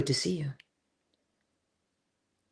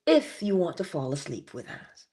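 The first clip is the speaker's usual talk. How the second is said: usual speaking voice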